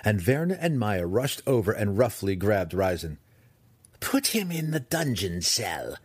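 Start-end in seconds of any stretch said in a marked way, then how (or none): 4.0-6.0 slow, high-starting imperious instruction; drawn-out matriarch's command; instructing. Acted. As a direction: hand down the order deliberately. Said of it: "Malice instructed them".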